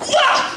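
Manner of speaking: high squeal of fright; a sudden squeaky fright shriek; startled yelp. Retold or imitated. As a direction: yelp like you just got jumped. Startled yell throwing candy.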